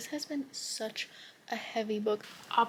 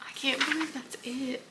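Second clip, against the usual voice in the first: higher, teary disbelief; tearful; 4 semitones higher than usual. Real, not acted. Reader just finished the book, tearful disbelief. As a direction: say it through tears, pitched up. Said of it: "a literal tear rolling down my face".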